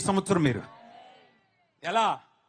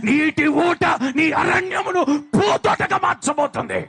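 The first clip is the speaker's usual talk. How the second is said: loud and higher, ending in a shout; full-force preaching; 4 semitones higher, 12 dB louder than usual. Acted. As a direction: thunder out each miracle. Proclaiming miracles, loud. (బిగ్గరగా అరిచే ప్రసంగం)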